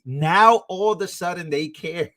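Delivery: usual speaking voice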